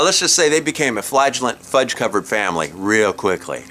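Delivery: ordinary talk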